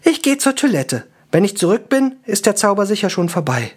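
high-pitched, breezy elf; high elf voice, unbothered; dismissive elf. Acted. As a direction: brush it off in a high voice. Elf figure speaks in the story.

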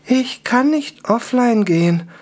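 slow, high elf voice, stunned; high, hollow elf statement; toneless elf. A narrator's reading. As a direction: say it slowly, drained, in a high voice. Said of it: "sagte Anker tönlos".

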